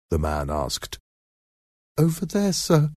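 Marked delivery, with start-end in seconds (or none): 2.0-2.9 put-on high, timid child's voice; frightened little boy. A narrator's reading. Boy answers the man about his mother, timid. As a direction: answer as a scared small boy, high-pitched.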